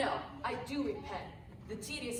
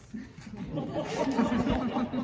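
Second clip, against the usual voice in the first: loud laugh; 7 dB louder than usual. Real, not acted. Loud laugh mid-scene.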